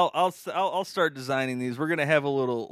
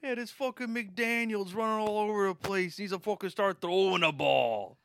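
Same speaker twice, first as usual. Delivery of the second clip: high, hammed Chicago sports fan; Chicago accent; 7 semitones higher than usual. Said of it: "a Chicago classic".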